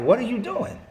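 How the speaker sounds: a mother catching her son mid-prank; shocked mom. Retold or imitated. Mother catches son knocking on doors, incredulous.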